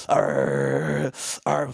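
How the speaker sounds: low, gravelly growl; dog growling. Pretend. Speaker voices the dog mauling Charlie, low growl. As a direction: growl like a dog chewing a leg. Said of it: "dog chewing on Charlie's leg".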